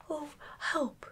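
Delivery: high-pitched, understated plea; mild cry for help. A narrator's reading. Pooh calls from inside the jar, mildly helpless.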